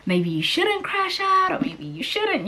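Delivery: high-pitched, drawn-out jokey suggestion; mock friendly advice. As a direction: playfully sing out a tactful hint. Voicing the book's girlfriend-style advice.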